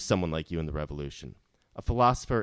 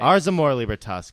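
louder, higher, proud declaration of the motto; rousing proclamation; 6 semitones higher, 11 dB louder than usual. Acted. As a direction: proclaim it like a battle cry. Proclaiming their grand motto.

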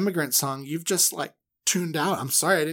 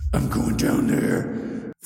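deep slow God; much slower than usual. Retold or imitated. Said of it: "And God goes".

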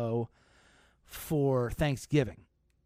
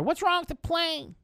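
mock panicky passenger; 3 dB louder than usual. Passenger woman demanding answers at the gate, much higher mock panic. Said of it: "this woman was yelling".

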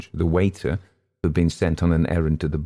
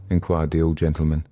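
ordinary delivery, nothing marked

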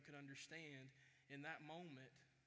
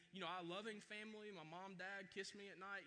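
ordinary delivery

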